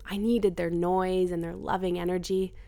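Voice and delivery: ordinary delivery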